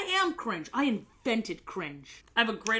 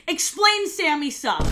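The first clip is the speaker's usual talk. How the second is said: louder demand; 6 semitones higher, 6 dB louder than usual. Real, not acted. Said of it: "One more argument".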